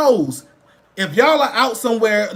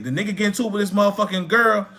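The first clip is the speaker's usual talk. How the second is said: ordinary talk, nothing marked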